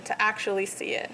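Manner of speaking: ordinary talk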